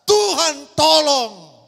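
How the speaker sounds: the crowd screams for God's help; loud shout. Retold or imitated. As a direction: bellow it like a crowd in terror. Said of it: "berteriak dengan sangat keras berkata".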